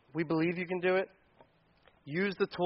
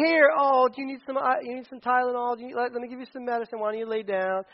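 fussing parent; 6 semitones higher than usual. Acted. Voicing parent fussing over sick child.

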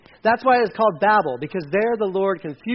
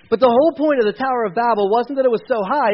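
usual speaking voice

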